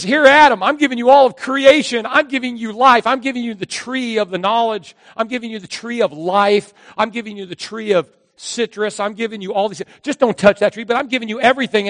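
God proudly handing over creation; booming benevolent proclamation; grand God voice. Acted. God giving creation to Adam.

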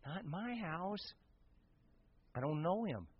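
very soft, acted aside; reluctant refusal. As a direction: back away quietly, under your voice. Voicing a reluctant helper.

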